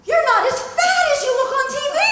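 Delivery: high, loud gushing fan with a laugh; gushing fan imitation. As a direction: squeal it like an excited fan.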